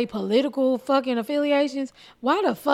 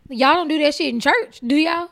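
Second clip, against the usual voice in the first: sassy challenge; 5 semitones higher, 4 dB louder than usual. Real, not acted. Rhetorical jab at audience.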